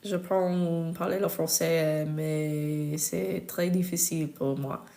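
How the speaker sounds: slow, careful French with an American accent. Shown as a demonstration. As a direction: speak French slowly as an American learner. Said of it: "The accent alone".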